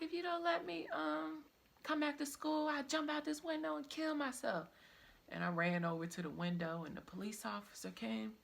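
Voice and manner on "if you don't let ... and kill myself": high, loud frantic retold threat; desperate threat. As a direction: threaten the principal in panic. Threatening to jump out window.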